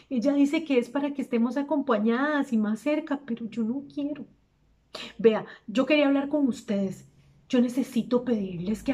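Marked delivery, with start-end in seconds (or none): 3.3-4.2 softer, hushed admission; quiet, reluctant refusal; confiding reluctance. Acted. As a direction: drop the volume to confess it. Repeats her confided reluctance.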